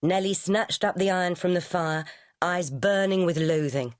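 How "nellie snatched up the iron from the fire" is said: ordinary delivery, nothing marked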